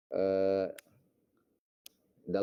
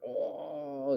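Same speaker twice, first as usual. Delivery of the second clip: retold yell; 7 semitones higher than usual. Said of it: "Dia teriak".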